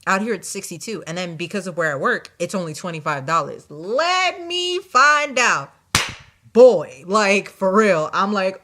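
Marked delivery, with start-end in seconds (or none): 3.7-5.7 sassy. Real, not acted. Sassy aside to the viewers. 6.5-8.1 loud exclamation. Real, not acted. Exclaiming to the viewers about the fare.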